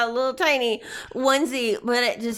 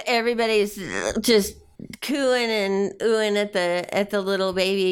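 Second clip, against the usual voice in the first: ordinary talk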